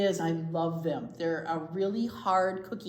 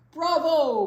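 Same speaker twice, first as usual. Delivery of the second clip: much louder, higher full-throated cheer; booming bravo; 9 semitones higher, 14 dB louder than usual. Acted. Grandpa cheering the singers, in a booming shout. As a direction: boom it out like a proud grandpa. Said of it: "booms Grandpa Regalado".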